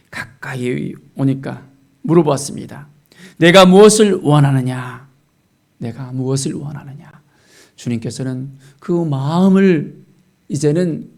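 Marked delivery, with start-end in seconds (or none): 3.4-5.1 loud, weighty question; solemn question. Retold or imitated. Jesus asking the blind man, solemn loud question. 5.8-7.1 softer, subdued question; hushed question. Retold or imitated. Jesus asking the blind man, hushed question.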